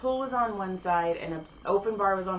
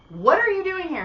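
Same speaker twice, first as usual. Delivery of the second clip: higher, louder call; 10 semitones higher, 6 dB louder than usual. Retold or imitated. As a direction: shout across to them. Calling to passing musicians.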